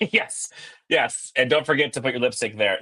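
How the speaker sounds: usual speaking voice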